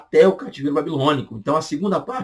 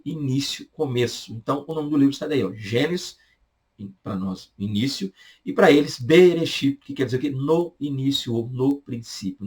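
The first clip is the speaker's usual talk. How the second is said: ordinary talk, nothing marked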